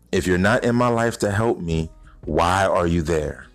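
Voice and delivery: normal talking voice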